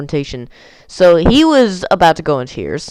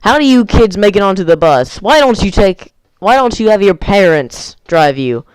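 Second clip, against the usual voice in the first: raised-voice scolding, mimicking an angry bus driver; imitated screaming rant; 4 semitones higher, 4 dB louder than usual. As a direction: tell off the kids, higher and emphatic. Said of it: "getting in his face and screaming stuff like".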